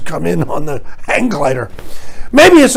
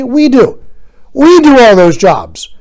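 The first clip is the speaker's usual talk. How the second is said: emphatic; 6 semitones higher, 6 dB louder, slower than usual. Real, not acted. Defiant working-class retort.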